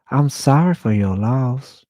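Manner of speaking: high, loud, slow flamboyant Southern condolence; Keith's Southern drawl. Retold or imitated. Keith from the pet crematorium consoling Andy, high flamboyant Southern voice. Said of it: "He kinda sounded like, ... little Southern accent".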